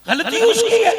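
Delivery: high-pitched, emphatic protest; indignant retort. Acted. Accused host blaming the one who jumped, indignant protest. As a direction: throw the blame back, voice jumping up.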